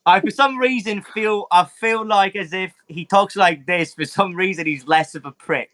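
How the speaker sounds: lightly voiced cocky Brit; his new voice. Shown as a demonstration. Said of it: "he sounds a little bit more like this now".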